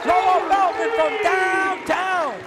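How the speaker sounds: high, loud, slow stretched call; excited shout. Real, not acted. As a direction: draw out the name like a big call. Three-pointer drops, live call.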